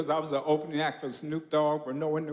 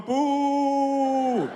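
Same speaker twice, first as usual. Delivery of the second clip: loud, high, long-held boo; booing heckler; 10 semitones higher, 5 dB louder than usual. Retold or imitated. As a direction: imitate an audience member booing at length. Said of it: "Your brother's going".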